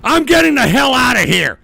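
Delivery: real fed-up outburst; angry shouting. Real, not acted. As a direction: yell a threat to walk out. Threatens trolls he will leave.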